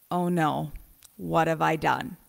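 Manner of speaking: a hint of someone caught; mock dismay. Imagining our own reaction to an angel, mildly dismayed. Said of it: "all of us would say first to ourselves".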